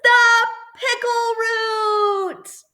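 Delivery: high, drawn-out, slow announcement; triumphant reveal. A narrator's reading. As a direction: stretch it out like a grand discovery. Big reveal in story.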